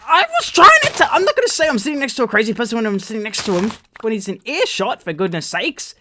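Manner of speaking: very high, loud start into rapid rant; exasperated outburst. Retold or imitated. Defending himself to his girlfriend, exasperated. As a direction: burst out in frustrated protest, easing at the end.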